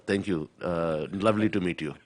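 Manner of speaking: understated, courteous Indian father's English; dad's Indian accent. Retold or imitated. Guest imitates his dad greeting people, gentle Indian accent. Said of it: "my dad would go".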